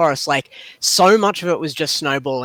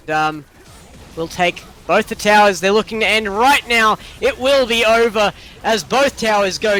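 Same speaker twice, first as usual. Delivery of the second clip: raised, driving esports caster voice; live play-by-play; 6 semitones higher than usual. Real, not acted. Caster calling the game-winning push, live play-by-play. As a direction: call the final push to victory.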